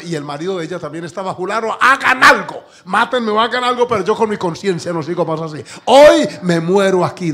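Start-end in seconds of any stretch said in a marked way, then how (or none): 1.3-7.3 sobbing plea for punishment; desperate wailing plea. Retold or imitated. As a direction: shout it like a woman at breaking point. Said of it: "decía".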